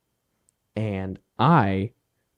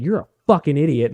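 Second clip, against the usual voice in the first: retold insult; 5 semitones higher, 5 dB louder than usual. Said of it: "swearing at her".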